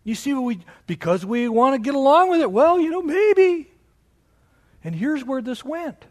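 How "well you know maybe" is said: high, louder mock hedging; pitched-up mimicry of a noncommittal believer; wishy-washy appeaser. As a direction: lightly imitate someone giving in to get along. Mimics people wanting to get along.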